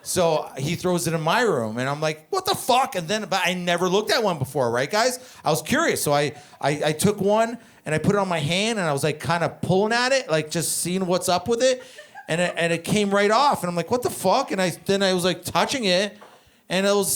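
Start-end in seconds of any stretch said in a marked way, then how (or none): 2.3-3.0 high-pitched retold bewilderment; mock "what is this?" reaction, pitched up; baffled. 13.9-14.5 pitched-up mock bewilderment; baffled. Baffled reaction in retold story.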